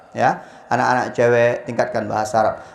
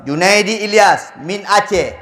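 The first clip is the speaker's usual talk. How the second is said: announcer calling a name; 6 semitones higher, slower than usual. Retold or imitated.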